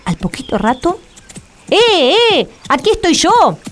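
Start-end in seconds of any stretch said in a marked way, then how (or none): 1.7-3.6 shouting high and loud. Acted. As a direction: call out to the brothers. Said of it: "volvió a gritar Juan el Bobo".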